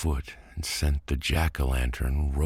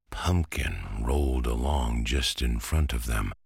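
plain reading voice

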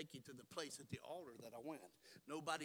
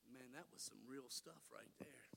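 awed whisper; 4 semitones lower, 6 dB quieter than usual. Acted. Peter's awed aside.

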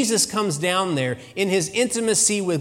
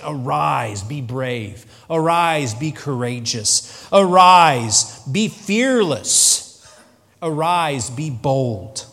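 each Arise louder, peaking on fearless, slow; preaching build; 4 dB louder, much slower than usual. Acted. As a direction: preach it, rising to a cry then easing. Preaching build of Jesus' call.

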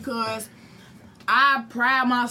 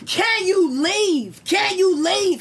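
higher, louder repeated demand; firm demand; 4 semitones higher, 3 dB louder, much slower than usual. Retold or imitated. She orders the landlord off her doorstep, raised and firm. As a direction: hammer the question home.

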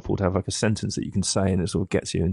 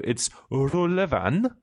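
Rullevan higher and very slow, with a rising Norwegian lilt; mock Norwegian accent; 6 semitones higher, much slower than usual. Shown as a demonstration. Host reading Google Translate aloud in an absurd Norwegian accent. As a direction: sing Rullevan up the scale like a Norwegian. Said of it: "some ridiculous sort of rhythm as if".

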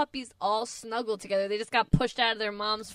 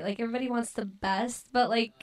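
ordinary talk, nothing marked

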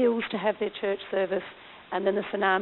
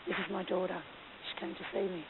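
retold tearful words; 13 dB quieter than usual. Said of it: "there's Gung crying".

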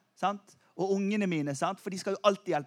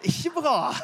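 amused; 7 semitones higher than usual. Real, not acted. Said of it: "Det var en som prøvde å etterligne".

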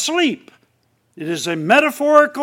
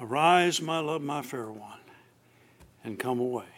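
soft, lower, fading loving call; tender scripture reading; 8 semitones lower, 12 dB quieter than usual. Acted. Preacher voicing the Lord's call to his bride, tender and soft. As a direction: read it like a lover calling gently.

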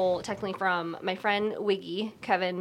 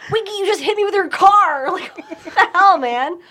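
high, loud mock outrage, breaking into laughter; retold outraged yell; 10 semitones higher, 9 dB louder than usual. Telling off the friend who hit her with his car, mock-outraged yell.

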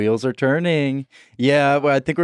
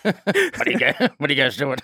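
Andy Kaufman impression. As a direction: play Andy Kaufman pestering the Grinch crew. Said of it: "Or whatever Andy Kaufman did".

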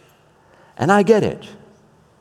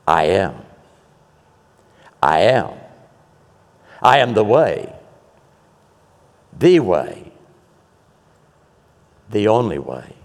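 much lower, solemn divine voice; like the voice from the bush; 6 semitones lower than usual. Acted. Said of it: "just as the voice from the bush".

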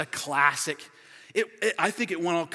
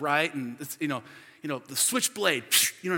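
normal talking voice

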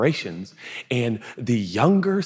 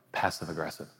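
ordinary delivery

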